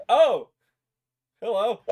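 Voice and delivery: high, loud startled oh, then a wry hello; mock surprise. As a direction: act jolted by a sudden gunshot. Head explodes, mock reaction.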